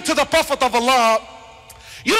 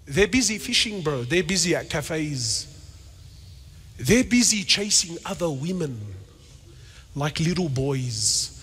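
scornful aside; 11 semitones lower, 6 dB quieter than usual. Acted. Scornful aside about absent men.

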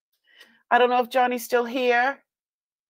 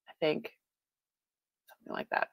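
whispered aside; 6 semitones lower, 13 dB quieter, much faster than usual. Real, not acted. Trailing aside.

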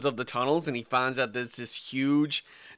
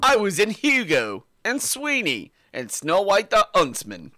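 mock cockney accent; 3 semitones higher than usual. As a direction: ham up a London accent. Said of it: "Us British people, we all sound the same".

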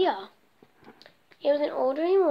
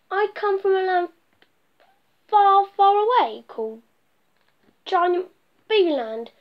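grand boast; 6 semitones higher, 6 dB louder than usual. Acted.

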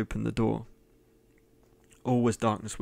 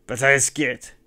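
triumphant scream; 3 semitones higher, 12 dB louder than usual. A narrator's reading. Host boasts to Cain of his escape, triumphant scream. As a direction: crow it in wild triumph. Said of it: "The scream rose triumphantly".

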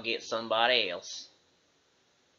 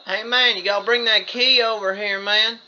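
calling out; 8 semitones higher, 10 dB louder than usual. Acted. Hillbilly calls to companion.

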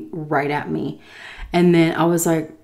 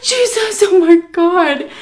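high, loud, drawn-out exclamation; retold shock; 13 semitones higher, 8 dB louder, slower than usual. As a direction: exclaim in exaggerated shock at the sight. Retold shock at hundreds of cats.

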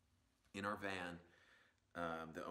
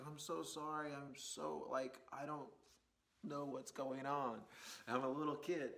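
higher, mock timid kid voice; sheepish apology; 6 semitones higher than usual. Young driver apologizing to the angry man, higher mock timid voice. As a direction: plead like a scared teenager. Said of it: "I'm like".

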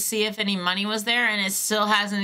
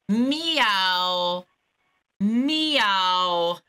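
cat meow. Pretend. Caller meowing while on hold, imitating a cat. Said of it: "very accurate cat sound".

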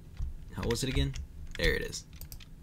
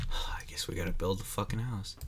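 whispering; 3 semitones lower, 7 dB quieter, faster than usual. Real, not acted. Resigned after a sigh.

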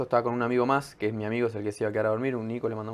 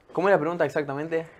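ordinary delivery

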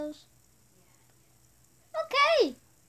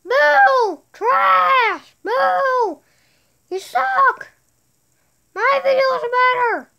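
higher, louder pretend heckling; mock jeering; 5 semitones higher, 8 dB louder, much slower than usual. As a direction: boo him like a rival. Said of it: "Now I have to say mean things".

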